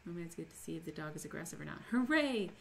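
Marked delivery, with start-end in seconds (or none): 1.9-2.5 high, loud "hooray" gliding into puppy talk; pitched-up, gleeful squeal of delight; excited cheer. Real, not acted. Mock cheer about getting a puppy.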